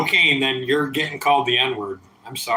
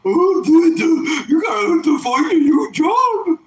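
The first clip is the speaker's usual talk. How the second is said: very high, loud, crying mock whine; shrill mock wail; mocking whiny voice; 13 semitones higher, 4 dB louder, slower than usual. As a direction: squeal it like a sobbing tattletale. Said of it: "so if you think you're fucking threatening me".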